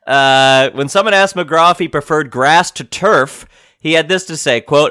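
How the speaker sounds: ordinary delivery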